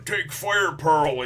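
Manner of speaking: high, loud, frenzied yell; a villain losing it mid-fight, mock-screaming; crazed battle cry. Pearl going crazy in battle, loud frenzied yell. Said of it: "Pearl starts going crazy".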